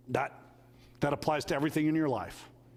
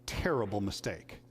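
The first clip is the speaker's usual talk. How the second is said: retold tearful; 6 semitones lower than usual. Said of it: "he was in tears".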